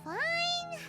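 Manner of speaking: drawn-out, high-pitched concession; huffy, exaggerated reluctance; grudging agreement. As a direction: give in reluctantly, high and stretched out.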